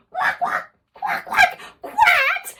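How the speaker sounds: high, breathless quacking; duck quacks. Pretend. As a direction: play the ducks for the kids. Imitating the ducks.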